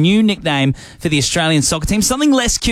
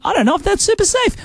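high, quick worried squeak; mock nervous squeak; faster than usual. Host jokingly voicing fear on a creaky show ride, high mock nervous squeak. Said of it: "hearing the creak of the rides and going".

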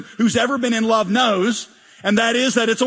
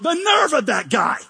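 higher, affronted outburst; mock indignation; 7 semitones higher than usual. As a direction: act scandalised at someone's cheek. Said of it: "if God doesn't behave, we're like".

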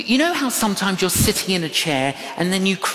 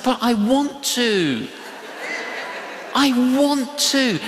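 higher-pitched, mock-childlike insistence; pleading protest; 7 semitones higher than usual. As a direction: plead to be allowed, pitch rising on the repeat. Acting out his protest to the Lord.

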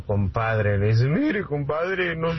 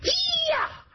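very high kiai yell; mock karate shout; karate cry. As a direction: shriek a martial arts cry. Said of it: "se pone en posición de ataque".

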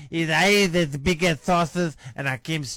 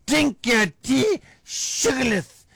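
high, slow scornful retort; mock angry retort; 8 semitones higher, slower than usual. Wife scornfully tells her husband to drink his sugarless tea. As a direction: spit it back at him, high and slow. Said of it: "I said".

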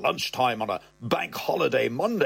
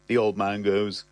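usual speaking voice

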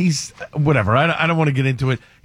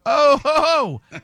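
mock incredulous; 8 semitones higher, much slower than usual. Dad reacting to son's lecture, mock incredulous scoff. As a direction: scoff in disbelief.

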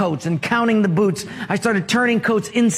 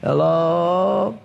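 tired voice; 3 semitones lower than usual. A narrator's reading. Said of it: "the voice on the other end sounded so tired".

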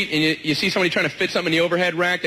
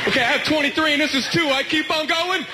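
high-pitched, worried dimwit voice; hammed-up bewildered passenger; confused passenger; 9 semitones higher than usual. Plays confused passenger counting seat rows.

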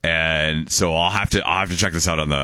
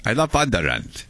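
higher put-on Viking accent; 4 semitones higher than usual. Shown as a demonstration. Host repeats his sample line, Viking accent. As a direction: say it as a Viking would. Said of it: "trying to do a Viking accent".

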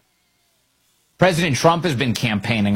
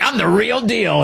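high, slow smug mimicry, higher; mocking impersonation; 9 semitones higher than usual. Host mocking a candidate's ad, high and slow. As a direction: parrot the candidate's boast. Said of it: "It's like".

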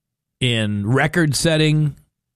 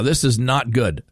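usual speaking voice

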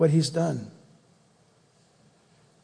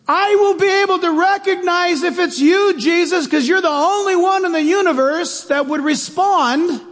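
much higher, loud call across the waves; calling out over storm; 10 semitones higher, 4 dB louder than usual. Retold or imitated. Peter calling to Jesus across the stormy water, loud. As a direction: shout it out over a raging storm.

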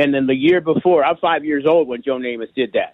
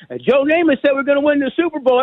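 excited dad; 10 semitones higher than usual. Retold or imitated. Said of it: "He goes".

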